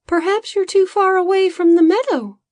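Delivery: high, light little assistant voice; a small worried helper explaining; Bodo's high voice. A narrator's reading. Assistant telling the farmer his master is affected, high voice. Bodo speaks as a character.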